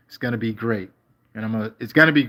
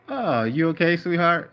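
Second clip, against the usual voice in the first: mock concern; 7 semitones higher than usual. Teasing a chatter on stream.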